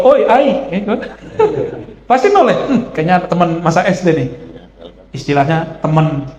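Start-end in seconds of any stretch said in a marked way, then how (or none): 0.0-0.5 high, loud familiar hail; calling out. Retold or imitated. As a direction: shout to an old school friend. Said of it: "Tapi kalau".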